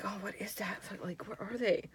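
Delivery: soft unvoiced whisper; hushed whisper in the dark. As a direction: breathe the words, hardly voiced. Whispering, eyes closed.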